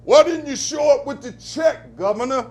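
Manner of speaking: loud, high shout at the governor; street voice shouting. Retold or imitated. Streets shouting at the governor, high and loud. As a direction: yell it like an angry crowd. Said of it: "Streets are saying".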